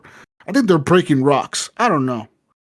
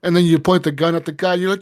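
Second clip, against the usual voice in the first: usual speaking voice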